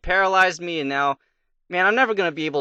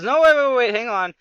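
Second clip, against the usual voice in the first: high, louder pleading to hold on; urgent interruption; 7 semitones higher, 4 dB louder than usual. Acted. Advisor stops the fed-up king, urgent interruption. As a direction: cut in hurriedly, pitched well up.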